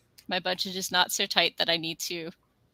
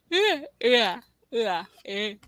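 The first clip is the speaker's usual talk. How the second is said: yawning; 2 semitones higher, slower than usual. Real, not acted. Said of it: "made me yawn".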